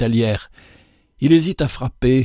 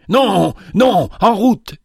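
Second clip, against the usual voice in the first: high, loud shout of refusal; cry; 8 semitones higher, 4 dB louder, slower than usual. A narrator's reading. Old father dragging the beggar along, shouting eagerly. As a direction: shout them down and set off. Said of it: "cria le vieillard".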